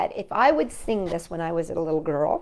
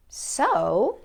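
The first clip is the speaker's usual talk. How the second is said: ordinary delivery, nothing marked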